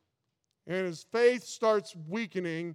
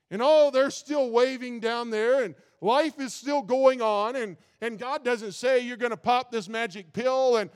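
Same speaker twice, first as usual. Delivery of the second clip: fervent preaching; 3 semitones higher, 5 dB louder than usual. Acted. Preacher urging the congregation, fervent and loud. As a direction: build it up and preach with fire. Preaching builds to full force.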